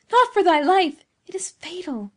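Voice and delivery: high anguished outburst, very loud, then dropping in volume; agonized cry. A narrator's reading. Beatrice warns Giovanni off the deadly shrub, agonized cry. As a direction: burst out in agony, then let the volume fall away. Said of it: "in a voice of agony".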